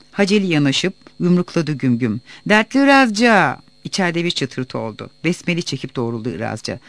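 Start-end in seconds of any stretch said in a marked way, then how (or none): 2.5-3.6 high, loud call through a door; shouted call. A narrator's reading. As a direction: holler to rouse a sleeper. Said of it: "Bir daha bağır, uyanıp çıksın". (kapıdan yüksek sesle çağırma)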